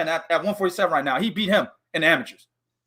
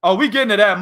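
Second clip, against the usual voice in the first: ordinary talk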